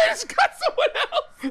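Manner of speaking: high laughing burst; can't stop laughing at the joke. Real, not acted. Reacting to co-host's voiceover joke, high laughing.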